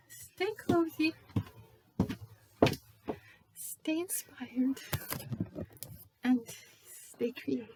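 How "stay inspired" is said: whisper. Real, not acted. Whispered sign-off to viewers.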